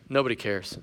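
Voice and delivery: low, soft and defeated; gloomy deadpan. Retold or imitated. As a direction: say it like the world ended. Gloomy stranger reads shirt.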